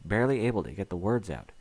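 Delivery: usual speaking voice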